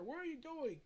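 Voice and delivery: ordinary delivery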